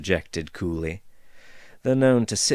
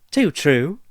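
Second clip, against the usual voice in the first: higher, louder cheery dwarf chirp; merry chirp; 8 semitones higher, 8 dB louder than usual. Acted. Dwarf agreeing with Caleb, a merry higher chirp. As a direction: chirp it merrily. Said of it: "chirped the dwarf merrily".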